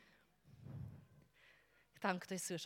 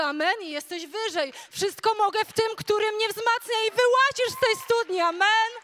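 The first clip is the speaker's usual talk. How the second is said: louder, higher, building fervent preaching; preaching at full force; 6 semitones higher, 12 dB louder than usual. Acted. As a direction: rouse the church to climb out. Preaching climax to congregation. (żarliwe, głośne kazanie)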